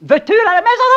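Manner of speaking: loud high scolding question; scolding the dog. Acted. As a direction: yell at the dog to go home. (gronder le chien fort)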